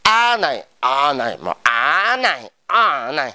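"a" said with changing tones; vary the pitch contour on each "a"; drawn-out "á" among differently pitched "a"s; varied tones. Shown as a demonstration. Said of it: "tiếng Hàn không có dấu".

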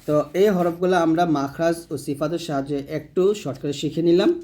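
ordinary delivery

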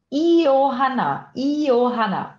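Iohanna, the J said like an English Y, slow and clear. Shown as a demonstration. Teacher modelling her own name Iohanna, slow and loud. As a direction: stretch out Iohanna with a Y sound. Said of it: "o som do J no meu nome, de Johanna, tem som de I".

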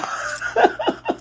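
breathless high giggle burst; a sudden airy squeal of laughter. Real, not acted. Laughing to viewers about the cat's drug dealer nickname, high squeal.

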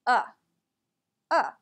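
two separate "uh" sounds, short and relaxed; relaxed schwa. Shown as a demonstration. Teacher repeating the sound for students, relaxed schwa. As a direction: say "uh" as an unstressed neutral vowel. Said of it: "listen to that again".

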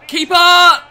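sudden high yell at the keeper; loud, high-pitched cry of protest; alarmed shout. Real, not acted. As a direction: yell it at your goalkeeper. Calls out as goal is conceded.

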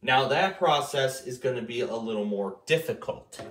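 usual speaking voice

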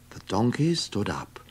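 plain reading voice

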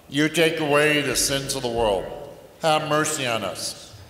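ordinary talk, nothing marked

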